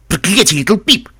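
loud, high-pitched exasperated curse; cursing. A narrator's reading. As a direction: swear it out loud at a companion. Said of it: "выругался Каламити".

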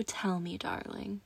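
soft teasing whisper. Acted. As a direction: breathe the word softly. Seductive roleplay whisper.

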